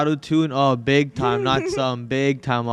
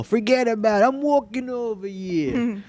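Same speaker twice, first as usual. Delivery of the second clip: high-pitched, hammed-up New York accent; 7 semitones higher than usual. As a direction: put on a playful, exaggerated New York accent. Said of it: "New York accent's so cool".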